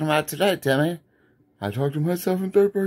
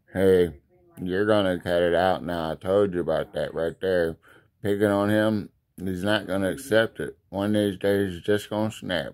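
much lower, warning dad voice; stern father figure; 8 semitones lower than usual. Acted. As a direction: lay down the law like an old dad. Fatherly voice warns about picking.

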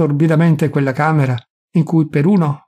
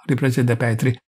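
ordinary delivery, nothing marked

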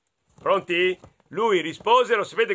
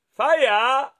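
lightly voiced doctor; 8 semitones higher, 5 dB louder than usual. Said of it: "Il dottore disse".

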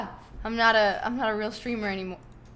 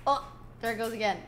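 ordinary talk, nothing marked

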